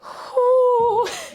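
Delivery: high, wailing exhale; high-pitched overwhelmed sigh; overwhelmed high hoot. Real, not acted. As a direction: let out a long high "whoo". Overwhelmed after retelling the surprise.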